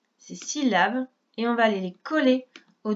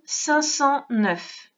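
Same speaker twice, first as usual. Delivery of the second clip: cinq cent neuf drawn out very slowly; very slow dictation; 3 semitones higher, much slower than usual. Shown as a demonstration. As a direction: spell it out slowly for a child. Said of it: "On écrit".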